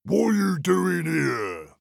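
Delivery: slow, gruff troll question. Acted. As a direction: ask it slowly like a troll. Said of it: "said the troll".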